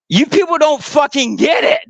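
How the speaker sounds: high cracking yell; unhinged shout. Real, not acted. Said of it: "You sound like a deranged".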